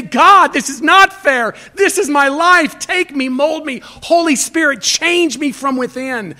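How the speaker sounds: loud, high pleading prayer; earnest prayer. Acted. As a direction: cry out to God from the heart. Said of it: "You say".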